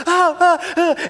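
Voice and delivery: high, sharp cries of pain, imitated; mock pained yelps. Pastor imitating the kid rolling in stickers, high pained cries. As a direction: yelp like a kid rolling in burrs.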